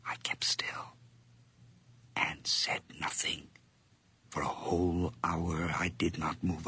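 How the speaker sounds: soft breathy whisper. Acted. Narrator frozen by the door, whispering very softly. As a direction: barely breathe the words.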